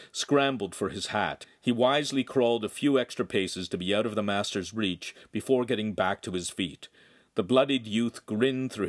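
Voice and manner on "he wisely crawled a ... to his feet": ordinary delivery, nothing marked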